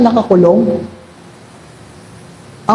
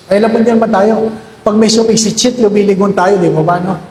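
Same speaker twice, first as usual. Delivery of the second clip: ordinary delivery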